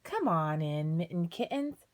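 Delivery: usual speaking voice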